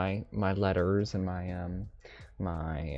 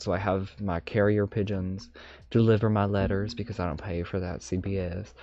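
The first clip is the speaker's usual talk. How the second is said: ordinary delivery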